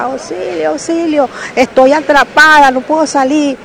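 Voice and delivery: retold cry for help. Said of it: "Entonces yo gritaba".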